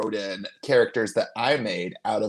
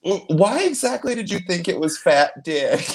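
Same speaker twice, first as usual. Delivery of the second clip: laughing; 9 semitones higher than usual. Real, not acted. Laughing disbelief at friend.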